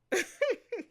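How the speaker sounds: rising squeal of laughter; high laugh. Real, not acted. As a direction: giggle up high. Laughing at Cody's answer.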